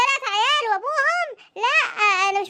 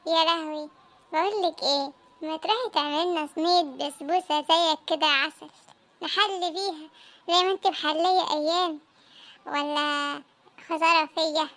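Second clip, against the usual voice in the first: low flirty coax; 6 semitones lower, 3 dB quieter than usual. Acted. Husband flirts asking for dessert.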